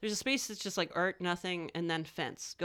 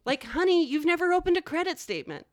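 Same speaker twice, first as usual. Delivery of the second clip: high, loud sassy put-down; 8 semitones higher, 5 dB louder than usual. Acted. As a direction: talk down to them, honey.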